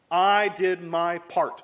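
indignant prophet insisting he has done his share; frustrated retort. Acted. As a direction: lean hard on each word in grievance. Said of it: "really in a state of frustration".

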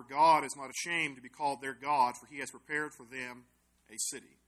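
loud, measured Bible reading; scripture reading. Acted. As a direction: read the verse out firmly to the group. Reads Bible verse aloud.

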